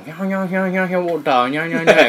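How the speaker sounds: fast babble with one clear word; imitating an unclear speaker, fast laughing babble; mock gibberish. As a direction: rattle nonsense, pop out ウォーター. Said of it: "やから".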